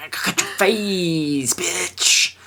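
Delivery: high, hammy villain sneer; villain taunt. Retold or imitated. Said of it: "He's like".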